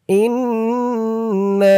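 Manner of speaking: ইন্না with the long stretched madd; slow chanted ইন্না; drawn-out recitation. Shown as a demonstration. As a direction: hold the vowel of ইন্না for a long count. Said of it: "মধ্যে মুনফাসিলের চিহ্ন দিয়েছে".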